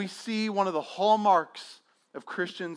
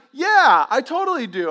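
eager answer; 8 semitones higher, 8 dB louder than usual. Retold or imitated. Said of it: "And he's like".

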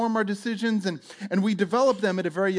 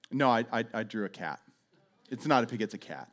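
low, hushed child owning up, imitated; quiet confession; 8 semitones lower, 7 dB quieter, faster than usual. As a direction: drop low and soft, confessing to Mom. Child confesses drawing to mother.

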